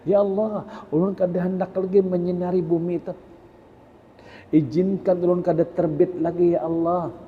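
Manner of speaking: a lightly voiced sun complaining to God; the sun pleading. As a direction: beg Allah to be excused from rising. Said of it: "Matahari itu pernah lapor lawan Allah".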